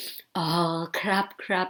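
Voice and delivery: soft, low polite agreement; lightly voiced Will. Dog owner agreeing aloud with the vet, mock-polite, soft and low. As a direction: play a man politely going along, hushed. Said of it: "แบบคุณวิวบอก".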